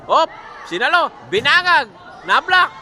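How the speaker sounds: rising shouts as each play happens; high excited commentary. Real, not acted. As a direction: call every touch, excited.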